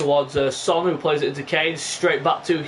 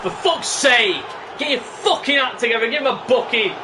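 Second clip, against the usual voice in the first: angry shouting; 5 semitones higher than usual. Real, not acted. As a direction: shout in real frustration. Yelling at the referee.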